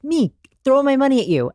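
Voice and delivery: high-pitched eager outburst; eager mock shark. Imitating sharks keen to invest, eager and high.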